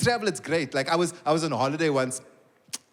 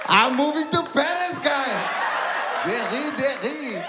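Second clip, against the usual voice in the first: exuberant announcement; 6 semitones higher, slower than usual. Acted. Father delighted by French woman's praise, exuberant. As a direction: crow it high, then draw out the last words.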